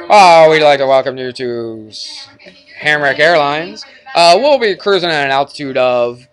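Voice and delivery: pilot voice. Pretend. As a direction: play the airline captain on the intercom, hammed-up. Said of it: "he does his pilot voice".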